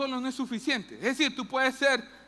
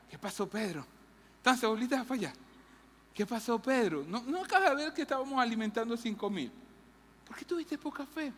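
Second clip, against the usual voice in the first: gentle coach tone; 4 dB quieter than usual. Retold or imitated. Jesus gently asking Peter what happened, soft coaching tone. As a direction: coax him kindly like a coach who wants to help. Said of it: "como un tono de un coach".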